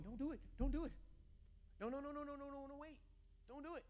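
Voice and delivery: high, rapid-repeated caution; urgent warning. Acted. God cautioning someone about a choice, urgent high warning.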